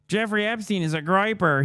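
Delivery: high, slow dopey fanboy voice; mocking dim fan. Streamer reading out a fan's post in a high dopey voice. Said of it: "another one of his fans".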